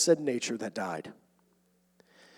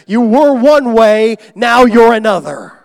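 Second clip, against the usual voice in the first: much louder and higher, slow; emphatic preaching; 7 semitones higher, 14 dB louder, slower than usual. Acted. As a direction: thunder it from the pulpit. Preaching climax.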